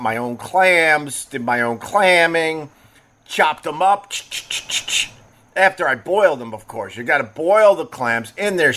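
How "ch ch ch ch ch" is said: rapid unvoiced chop-chop sound; quick percussive "ch" bursts; chopping noise. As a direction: imitate a knife on a board. Imitates chopping the clams.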